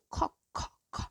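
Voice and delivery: Ko whispered, only air, no voice; breathy blown k. Shown as a demonstration. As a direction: blow out the k sound softly. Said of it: "ele é assoprado".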